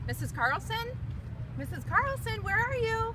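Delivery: calling out. Real, not acted. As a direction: call out, searching for someone. Calling for colleague.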